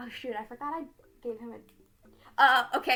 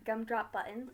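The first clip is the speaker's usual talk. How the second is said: usual speaking voice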